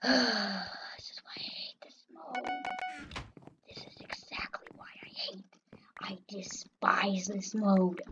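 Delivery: defeated whisper. Real, not acted. Player beaten by the mode he hates, defeated whisper.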